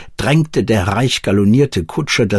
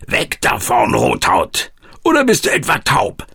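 coachman's harsh call, rising higher on the question; gruff shout; 4 semitones higher than usual. A narrator's reading. Coachman yelling at a man walking in his carriage's path, gruff call. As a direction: call out roughly, as if to someone in the way. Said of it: "trotz des barschen und lauten Zurufs".

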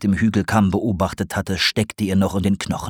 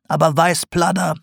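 higher, knowing teasing voice; sly old potter; 7 semitones higher than usual. A narrator's reading. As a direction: tease the owl like a crafty old potter. Sarg continues.

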